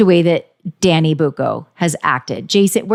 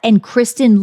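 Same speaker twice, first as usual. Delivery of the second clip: ordinary talk, nothing marked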